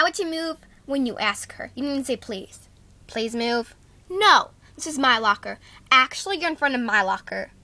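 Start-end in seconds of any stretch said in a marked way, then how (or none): 4.1-4.5 sharp shouted no; loud refusal. Acted. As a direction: yell it right back. Refuses to move again.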